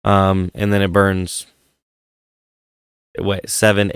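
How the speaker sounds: ordinary talk, nothing marked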